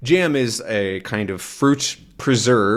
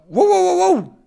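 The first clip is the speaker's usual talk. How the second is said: high yelping dog; 5 dB louder than usual. Acted. Snowy grabs the arm.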